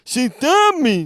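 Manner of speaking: wildly exaggerated Russian accent, shouted; high, loud, slow mock Russian; Victor. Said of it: "He didn't talk like that".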